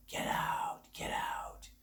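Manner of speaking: soft, voiceless horror-movie whisper; demon whisper. Retold or imitated. Demon from an old horror movie warning, hushed whisper. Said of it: "where the demon's like".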